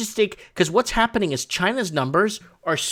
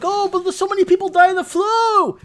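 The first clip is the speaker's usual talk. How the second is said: mocking objectors; 6 dB louder than usual. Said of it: "so people are like".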